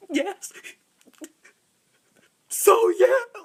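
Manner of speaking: high, gasping mock sobs between words; imitated crying speech. Shown as a demonstration. Daughter showing how she talks when crying, mock sobbing. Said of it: "I can never just st-, talk normally".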